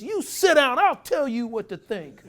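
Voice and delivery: high, loud exasperated manager; high, angry manager voice. Retold or imitated. Manager berating his players over the book, high and loud. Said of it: "He says".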